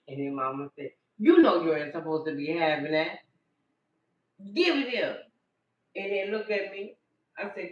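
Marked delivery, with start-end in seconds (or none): 1.2-5.2 loud stern scold; snatch the sweets back, scolding; mother chiding her child loudly. Retold or imitated. Mama scolding child over sweets.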